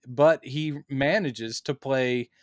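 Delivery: ordinary talk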